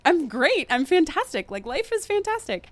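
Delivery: high, gushing imitation of being great; sing-song cheerful reassurance, pitched high; acted upbeat. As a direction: insist brightly that all is wonderful. Acting out her upbeat answer to friend.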